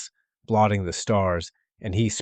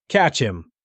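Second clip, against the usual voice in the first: loud, raised command; shouted order; 4 semitones higher, 5 dB louder than usual. A narrator's reading. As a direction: bark the order across the hall.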